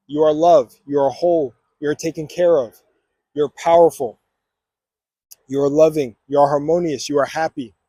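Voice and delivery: steady, deliberate affirmations; affirmation reading. Acted. Vlogger leading viewers through affirmations, steady and declarative. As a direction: declare each one with calm conviction.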